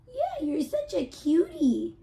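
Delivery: drawn-out gush over a cute pet; high slow cutie coo. Real, not acted. Cooing at her cat.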